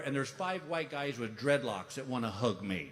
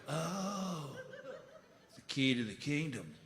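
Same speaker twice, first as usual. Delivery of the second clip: stoner voice; 7 dB quieter than usual. Retold or imitated. Stoner kid voiced in story.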